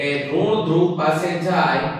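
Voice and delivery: normal talking voice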